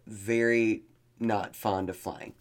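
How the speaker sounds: normal talking voice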